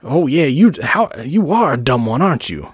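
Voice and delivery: single out your mark for the crowd; showman. Acted. Doctor Paul picking his planted boy from the crowd, showman's patter.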